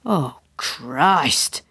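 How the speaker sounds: low groan of dread; drawn-out, unexcited oath; dismayed. A narrator's reading. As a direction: let it sink out in dismay. Tim caught out, first reaction.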